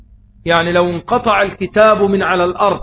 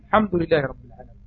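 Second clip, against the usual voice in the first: imitating a weeping recitation; 3 semitones lower, 6 dB quieter, faster than usual. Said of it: "بكى وهو بيقرأ الفاتحة".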